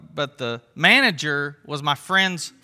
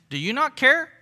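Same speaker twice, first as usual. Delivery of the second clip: much higher, pleading rise on care; irritated question; 9 semitones higher than usual. Retold or imitated. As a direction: ask it with mild annoyance. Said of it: "she's a little irritated with Jesus".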